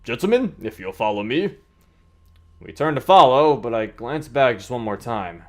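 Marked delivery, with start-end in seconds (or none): 0.0-1.6 lightly voiced game officer, louder and higher; raised, businesslike character voice; Joshua, brisk escort. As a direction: project like a guide making introductions.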